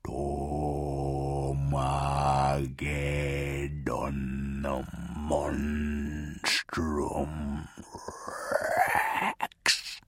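very slow, low, soft demonic chant; doom voice. Acted. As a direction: intone like a monstrous doom demon. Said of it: "the doom voice of the demon monster".

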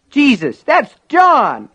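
high, loud exclamation; startled cry. A narrator's reading. Norris recognizing injured John, crying out. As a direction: cry it out at the top of your voice. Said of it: "Norris cried".

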